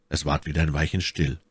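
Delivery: usual speaking voice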